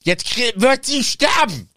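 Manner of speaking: high, loud, slow outburst; mock villain threat. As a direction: snap from sulking into a ham threat. Voldemort droht gespielt.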